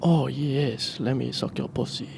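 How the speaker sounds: soft, put-on Latin-lover accent; sexy hybrid accent. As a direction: murmur it in a mock Spanish-Italian-French accent. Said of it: "my interpretation of that accent".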